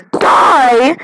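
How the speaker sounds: sudden drawn-out loud cry; dramatic outburst. Retold or imitated. Kid worried about being shot, dramatic outburst. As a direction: burst out on the last word.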